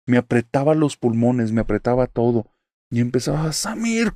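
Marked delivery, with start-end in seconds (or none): 3.5-4.1 much higher, strained cry of a name; desperate call. Retold or imitated. Trapped in the nightmare, straining to call her husband's name. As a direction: call his name with all the strength left. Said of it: "con todas mis fuerzas".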